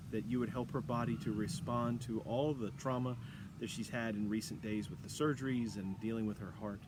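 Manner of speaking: soft, earnest prayer voice; a pastor praying for sick friends, hushed and earnest. Acted. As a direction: pray it quietly and reverently. Leads a prayer.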